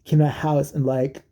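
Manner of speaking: ordinary talk, nothing marked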